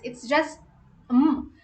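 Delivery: usual speaking voice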